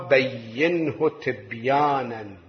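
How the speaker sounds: very slow, each word set apart; slow measured reading. Shown as a demonstration. Preacher showing how to read with care, slowly. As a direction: draw the words out calmly. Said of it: "بتأني اقرأ، بهدوء".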